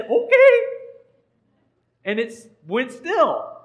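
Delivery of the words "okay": high, loud cartoonish okay; high-pitched instant compliance; obedient storm. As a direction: squeal a startled okay as the storm. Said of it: "the storm said".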